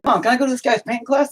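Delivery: mock kid. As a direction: beg like an eager child. Imitating kid asking mom.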